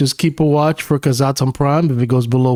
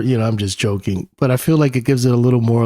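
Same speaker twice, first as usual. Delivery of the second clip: ordinary talk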